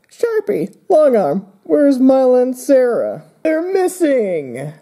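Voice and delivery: high-pitched panicked calling out; alarmed. Acted. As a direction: cry out for missing friends, stretching the end. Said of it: "looking really concerned, and I'm like".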